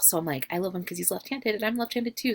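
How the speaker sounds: normal talking voice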